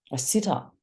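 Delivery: usual speaking voice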